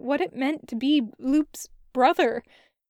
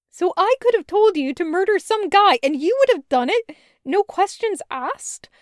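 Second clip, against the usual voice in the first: lightly voiced girl, higher, disbelieving; incredulous sister; 6 semitones higher, 3 dB louder than usual. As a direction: challenge him, half in disbelief. Sister confronting her brother.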